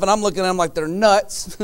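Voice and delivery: ordinary delivery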